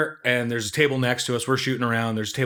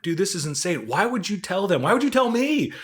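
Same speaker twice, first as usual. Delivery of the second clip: incredulous; 10 semitones higher than usual. Retold or imitated. Retells own incredulous reaction.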